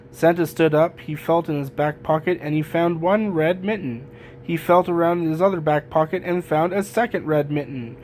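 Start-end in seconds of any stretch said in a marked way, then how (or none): none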